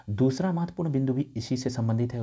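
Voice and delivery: usual speaking voice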